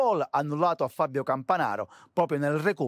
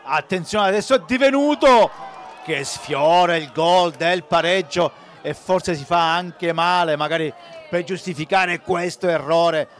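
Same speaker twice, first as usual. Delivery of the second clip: louder play-by-play call; loud excited commentary; 7 dB louder than usual. Acted. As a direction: shout the near miss. Near miss in live commentary.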